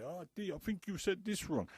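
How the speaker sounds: high mock voice of a cocky kid; mocking young guy. Speaker imitating a young man on the road, high and mocking. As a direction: imitate the little clown correcting him. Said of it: "he's gonna say".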